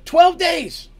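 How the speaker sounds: high, loud, slow drawn-out call; shouted answer. Acted. Navigator answering the captain from afar, shouting. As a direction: holler it back across the ship.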